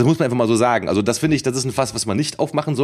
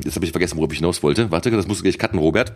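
normal talking voice

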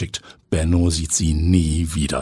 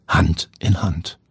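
ordinary delivery, nothing marked

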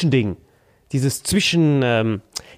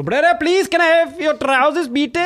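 much higher, begging; pleading high voice; 12 semitones higher than usual. Retold or imitated. Stranded traveller begging staff for their trousers, high pleading voice. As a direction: beg like a desperate tourist. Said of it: "Ich war so".

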